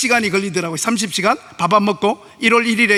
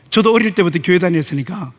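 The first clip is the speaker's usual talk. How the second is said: ordinary talk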